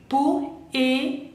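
"Poésie" broken into po, é, zi with pauses; syllable-by-syllable. Shown as a demonstration. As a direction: sound out "Poésie" slowly, s as z. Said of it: "La S, acuérdense".

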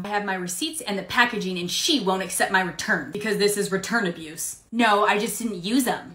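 usual speaking voice

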